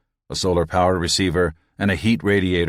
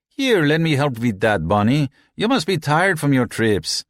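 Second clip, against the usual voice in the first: teasing crewman; 7 semitones higher than usual. A narrator's reading. Red crewman teases newcomer.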